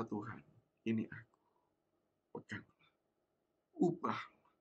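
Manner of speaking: soft, halting near-whisper; hushed prayer. Acted. Believer surrendering to God, hushed prayer. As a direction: pray quietly, pausing between each plea.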